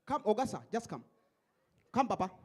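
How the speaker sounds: ordinary talk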